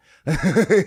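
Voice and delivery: hearty self-amused laugh; burst of laughter. Real, not acted. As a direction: let a big real laugh burst out. Laughs at his own story.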